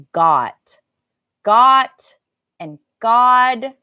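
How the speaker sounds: got, got and God loud with the same ah; match the vowel in got and God. Shown as a demonstration. Teacher contrasting the final T and D. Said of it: "they have the same vowel sound".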